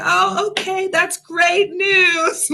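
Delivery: high, stretched-out congratulations; feigned delight. Retold or imitated. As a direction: humour them with sugary fake cheer. Feigned delight at delusional claim.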